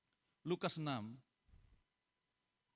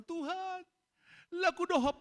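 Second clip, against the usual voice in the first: mock pleading believer; 14 semitones higher, 4 dB louder than usual. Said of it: "Bilang sama Tuhan".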